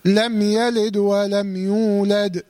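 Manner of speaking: slow chant, "يلد" closed on a bouncing vowelless dal; dal with qalqala. Shown as a demonstration. Teacher modelling the rule, chanting "يلد" with still, bounced dal. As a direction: stop the dal of "يلد" and "يولد" with a small echoing release. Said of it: "لازم أقلقل الدال".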